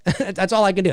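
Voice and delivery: ordinary talk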